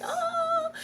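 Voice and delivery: sudden high squeaky Oh of delight; a surprised, delighted little gasp of a word. Retold or imitated. Mother delighted by her son's eye contact, high surprised oh. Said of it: "you're just like".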